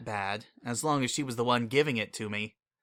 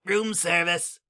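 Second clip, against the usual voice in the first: calling out; 5 semitones higher, 3 dB louder, slower than usual. Acted. Calling through the door.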